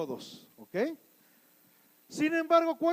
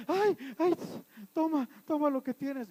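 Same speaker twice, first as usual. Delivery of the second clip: higher, lightly panicky voice; mock frightened victim; 3 semitones higher than usual. Timid person handing goods to a robber, mock fright. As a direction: give in with a touch of mock fear. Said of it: "¿Qué haría otra persona?".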